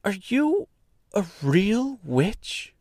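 high, slow, wondering question; awed young girl. A narrator's reading. Girl asks the woman if she is a witch, awed. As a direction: ask in a girlish high voice, amazed.

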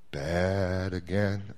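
low, slow, sheepish "bad again"; low and slow, ashamed of himself; sheepish admission. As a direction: mumble it like a caught kid. Mike sheepishly answers God.

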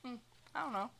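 mock-casual shrug in the voice, softly; soft, noncommittal imitation; unfazed. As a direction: play it lightly unfazed, brushing the question off. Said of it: "Eli doesn't seem fazed".

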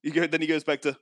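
ordinary talk, nothing marked

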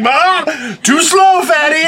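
high, stretched-out imitated taunt; mock taunt. Host imitating co-host fleeing after stabbing him, mock taunting.

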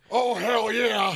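high, shouted wrestler catchphrase; hammed-up wrestling yell; Steve. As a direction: stretch it out in a long shouted impression. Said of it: "Steve, is that you?".

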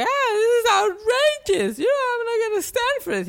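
very high, drawn-out American woman's fuss; mock outraged American. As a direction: shriek it like an indignant tourist, hammed up. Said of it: "una gritería".

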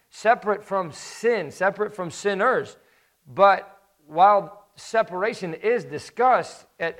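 fervent sermon climax, pitch lifted; rising preaching. Acted. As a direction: drive the point home like a preacher building up.

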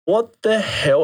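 retold what-the-hell amazement; astonished. Runner realizing his feet match the tempo, astonished.

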